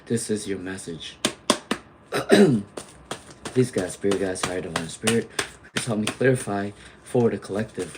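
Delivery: low, hushed prayer to the spirit guides; soft invocation. Acted. Reader invoking spirit guides for her viewers, hushed and reverent. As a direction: call on the guides softly and reverently. Opens tarot reading with invocation.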